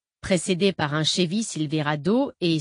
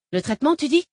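high, rising, louder incredulous question; indignant challenge; 9 semitones higher, 4 dB louder, faster than usual. A narrator's reading. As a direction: throw the word back at him indignantly. Gamin reprend le mot, outré. (question outrée, aiguë et montante)

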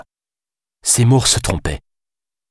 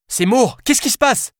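higher anxious call into the phone; crying out. A narrator's reading. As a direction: call down the line, scared. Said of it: "cria-t-elle dans le combiné". (Alice crie dans le combiné, inquiète)

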